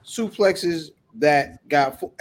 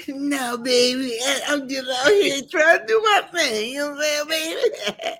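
hyped voice; 11 semitones higher than usual. Acted. Acting out a character voice.

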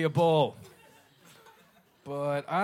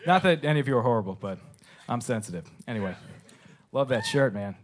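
ordinary talk, nothing marked

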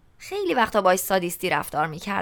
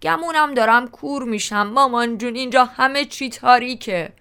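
hammed-up husky plea, a little higher; mock husky voice; 4 semitones higher, 4 dB louder than usual. A narrator's reading. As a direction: clown around as a helpless blind child calling mama. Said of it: "با صدای دو رگه گفتم". (صدای دورگهٔ مسخره و نمایشی)

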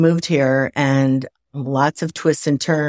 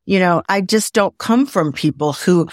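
usual speaking voice

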